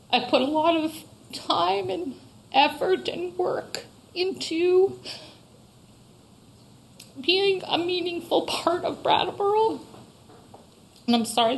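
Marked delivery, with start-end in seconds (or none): 0.1-9.8 fighting back tears while speaking up; getting emotional. Real, not acted. Shop owner at town meeting, voice breaking emotionally. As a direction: voice rising high and strained, near tears. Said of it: "I'm getting emotional".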